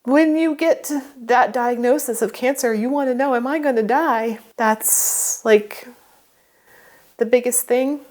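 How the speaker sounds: words breaking with emotion; crying. Real, not acted. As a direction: speak through real tears. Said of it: "I'm crying again".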